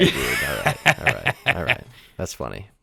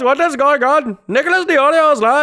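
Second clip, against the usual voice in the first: put-on high-pitched caricature Indian accent; fake Indian accent; 13 semitones higher, 4 dB louder than usual. Mimicking a streamer's old catchphrase, fake Indian accent. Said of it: "the fake stereotype Indian accent".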